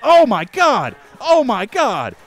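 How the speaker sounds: loud, high panicked oh my God; startled shriek. Real, not acted. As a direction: jolt with real fright at the cat. Startled by a dangerous cat.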